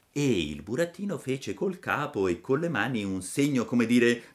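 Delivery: usual speaking voice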